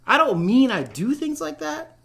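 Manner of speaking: insistent correction, stress on "mean"; emphatic denial. A narrator's reading. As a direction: hit "mean" hard to set it straight. Narrator denies doing crimes.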